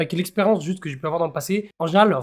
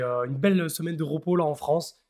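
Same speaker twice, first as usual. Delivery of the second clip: ordinary talk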